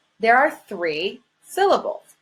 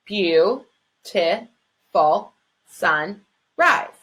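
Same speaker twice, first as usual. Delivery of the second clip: counting syllables; 2 semitones lower, slower than usual. Shown as a demonstration. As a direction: tap out Beau-ti-ful sunrise one syllable at a time. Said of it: "Let's check that that's five syllables".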